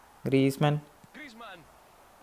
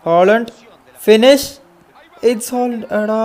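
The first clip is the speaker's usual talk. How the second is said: rising yell as Haaland shoots; loud, high burst of hype; excited shout; 6 semitones higher, 7 dB louder, slower than usual. Real, not acted. Gamer yelling as his striker finishes, excited shout. Goal chance in FIFA match.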